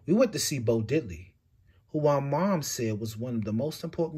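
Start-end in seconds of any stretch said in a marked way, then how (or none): none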